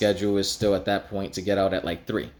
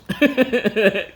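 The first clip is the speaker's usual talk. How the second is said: high, loud little mocking laugh from the sister; mock teasing laugh; 13 semitones higher, 4 dB louder than usual. Big sister laughing at her brother, high mocking laugh. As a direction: cackle like a smug big sister.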